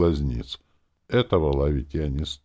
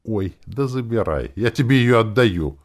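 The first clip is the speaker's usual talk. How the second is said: irritated, louder and higher toward the end; angry; 7 semitones higher, 6 dB louder than usual. A narrator's reading. Man giving in to Настя's demand, angry. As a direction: let irritation build as you hand it over. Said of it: "сказал я со злостью".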